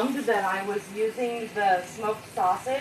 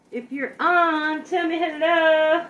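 higher, slow and louder call; eager call-out; 7 semitones higher, 5 dB louder, slower than usual. Real, not acted. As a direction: coax viewers to say hello. Calling out to live viewers.

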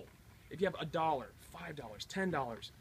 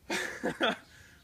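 breathy shocked laugh from the cold; breathless laugh; 9 semitones higher, 6 dB louder than usual. Real, not acted. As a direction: laugh it off while freezing. Just doused with ice water.

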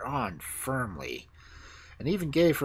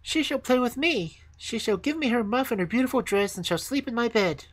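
put-on high, demanding little-girl voice; insistent little daughter, pitched high; crying out; 12 semitones higher, 4 dB louder than usual. A narrator's reading. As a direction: lay claim to a new playmate in a high voice. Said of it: "cried her little daughter".

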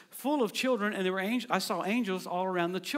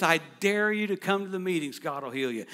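usual speaking voice

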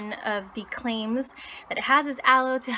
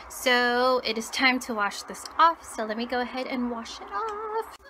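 stiff limited jaw movement; clay mask on chin. Real, not acted. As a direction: lips barely moving. Seen 2: Pale clay mask covers chin throughout, visibly dry and cracked later.

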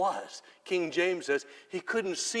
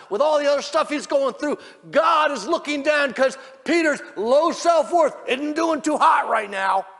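high, loud impassioned sermon shout; slowing, hammered-out pulpit shout; full-force preaching; 11 semitones higher, 14 dB louder than usual. Real, not acted. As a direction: preach it at the top of your voice.

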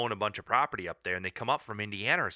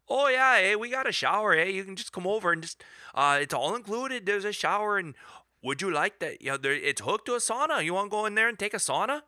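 high, eager shopkeeper voice, fast at the end; imitating Rob; 10 semitones higher than usual. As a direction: play the chatty store owner offering a sauna. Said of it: "He's like".

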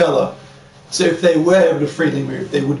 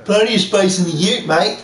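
slightly raised, put-on Australian accent; mock Australian accent; 4 semitones higher than usual. Suggesting a line for the game character, mock Australian. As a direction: ham up the Australian a little. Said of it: "You should say".

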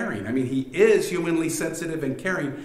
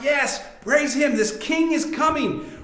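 calling out; 11 semitones higher than usual. Acted. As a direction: call out praise as an eager parade crowd, pitched well up. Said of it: "They're calling out".

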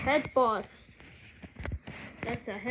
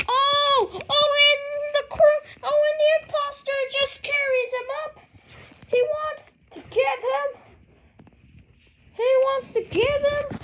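excited commentator; 11 semitones higher, 6 dB louder than usual. Acted.

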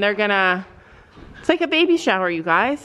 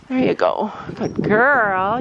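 ordinary talk, nothing marked

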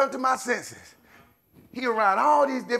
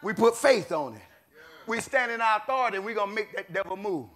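usual speaking voice

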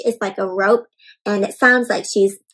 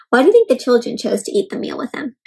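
ordinary talk

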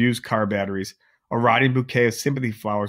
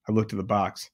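plain reading voice

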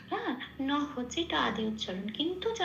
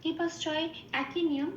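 ordinary talk

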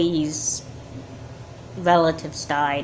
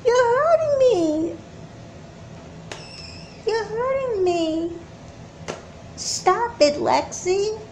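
high, slow, whimpering plea; fading pained plea; hurt whimper; 13 semitones higher, slower than usual. Acted. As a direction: say it through pain, then weaker. Being hurt by sister.

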